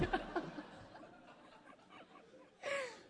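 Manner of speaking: high breathy burst of laughing; 思わず笑う; real laughter. Real, not acted. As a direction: crack up mid-sermon. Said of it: "自分でも笑ってしまい".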